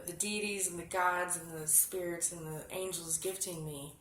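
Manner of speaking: normal talking voice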